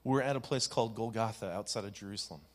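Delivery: gentle meditation guide with long pauses; guided meditation. Acted. Youth pastor guides the congregation into reflective meditation, gentle and unhurried. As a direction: ease them into the scene, unhurried.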